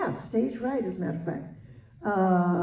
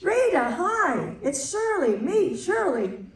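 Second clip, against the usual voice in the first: higher, slow, sing-song greeting; Shirley MacLaine greeting her old friend Rita in a hotel lobby, sing-song; imitating Shirley MacLaine; 7 semitones higher than usual. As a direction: stretch it out sweetly. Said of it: "Shirley MacLaine saying".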